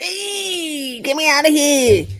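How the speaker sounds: high squealing cat voice; voicing the cat. The cat begging to be let go, squealing. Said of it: "He's like".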